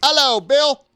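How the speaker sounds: high, loud, badly faked London accent; a hammed-up Cockney greeting. Tiki greeting a listener in a bad London accent. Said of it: "That was my best London accent".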